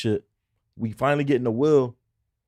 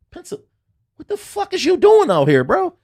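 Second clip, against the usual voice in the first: high, loud incredulous cop; imitating cop; 8 dB louder than usual. Cop reading his ID in disbelief, high loud. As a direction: bark it like a stunned cop. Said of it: "He like".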